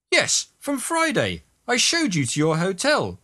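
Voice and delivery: high-pitched, emphatic reminder; insistent bumpster. A narrator's reading. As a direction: jog their memory in a high, pushy voice. Bumpster insists they have met.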